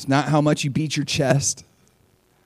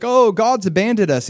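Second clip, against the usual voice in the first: mock lament; 8 semitones higher, 3 dB louder than usual. Mocking mourning Israelites.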